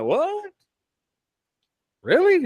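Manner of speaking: very high-pitched, astonished questions; amazed disbelief. Retold or imitated. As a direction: squeak it out in mock astonishment. Retells disbelief at being heard.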